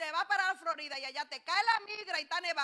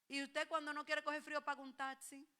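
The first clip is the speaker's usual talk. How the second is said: usual speaking voice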